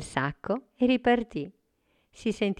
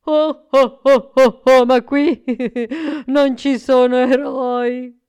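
booming ho ho ho, laughing; hearty laugh; 8 semitones higher, 14 dB louder than usual. Acted. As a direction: laugh from the belly as you talk. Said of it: "Babbo Natale rise di cuore". (risata di cuore)

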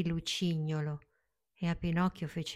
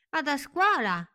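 proud; 9 semitones higher than usual. Acted. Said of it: "rispose Pinocchio con orgoglio".

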